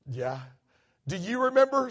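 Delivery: usual speaking voice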